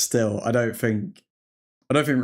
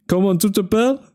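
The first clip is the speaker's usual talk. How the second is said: mock French; 7 semitones higher, 4 dB louder than usual. Streamer tries French on chat.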